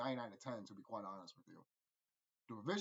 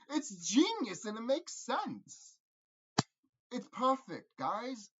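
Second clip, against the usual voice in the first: slow, drawn-out, louder and higher gushing; emphatic praise; 7 semitones higher, 6 dB louder, much slower than usual. Real, not acted. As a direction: savor every word like a fan's final verdict. Gushing verdict on the sequel.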